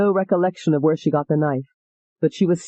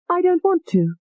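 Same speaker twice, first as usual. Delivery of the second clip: frightened refusal; 13 semitones higher than usual. Acted. Refusing the man.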